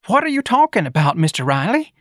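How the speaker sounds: high, alarmed questioning; frightened office manager. A narrator's reading. Office manager questioning Mister Riley, frightened. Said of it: "in a frightened voice".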